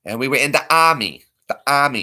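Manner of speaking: army said "AH-mee", r dropped; broad Boston ah in army; Boston accent. Shown as a demonstration. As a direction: drop the r in army, Boston style. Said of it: "how you spell army in Boston".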